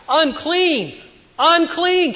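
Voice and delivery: high, drawn-out public cry; crying out unclean. Acted. Leper warning everyone around him, high crying call. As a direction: cry it out like an outcast warning the street. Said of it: "Going around and crying".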